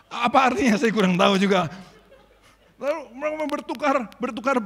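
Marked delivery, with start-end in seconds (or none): 0.1-1.7 louder, laughing through the words. Real, not acted. Preacher laughing with the congregation. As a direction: laugh at your own guess.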